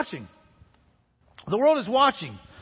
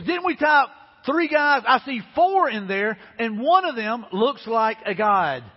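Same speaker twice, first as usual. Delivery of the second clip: acted amazement; 3 semitones higher, 4 dB louder, slower than usual. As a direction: exclaim it like a king who can't believe his eyes. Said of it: "jumped up in amazement and exclaimed".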